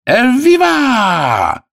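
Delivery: high, long-drawn loud cheer; cheering shout. Acted. Italian sailors applaud the runners, long high shout. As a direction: cry it out high and stretch it. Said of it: "кричат".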